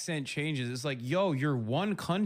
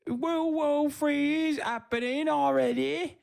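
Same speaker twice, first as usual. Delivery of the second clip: high, loud, exaggerated mimic of the man; squeaky overblown parody of his voice; mocking impression; 12 semitones higher, 4 dB louder than usual. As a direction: ham up his doom-laden warning, pitched high and loud. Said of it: "He's like".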